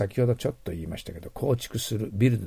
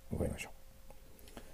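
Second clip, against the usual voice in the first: whisper; 6 semitones lower, 18 dB quieter, slower than usual. Real, not acted. Teacher ending a word list.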